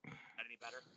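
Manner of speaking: distant faint question off mic; far from mic. Real, not acted. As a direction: ask from far away from the mic. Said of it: "it sounds like you're far away".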